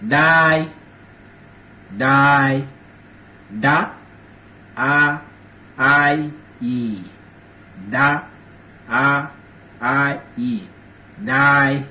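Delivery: die broken into da, a, I, e, slow and separated; syllable-by-syllable demo. Shown as a demonstration. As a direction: sound out die piece by piece for learners. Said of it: "Die mean".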